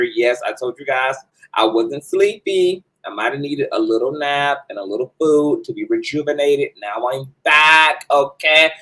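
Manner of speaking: ordinary talk, nothing marked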